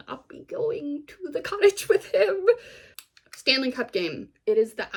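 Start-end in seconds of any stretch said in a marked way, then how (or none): 0.1-2.5 high, loud, hammed-up plaintive voice; mock pained reluctance. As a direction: climb high as if agonizing over it. Acting out a reluctant refusal.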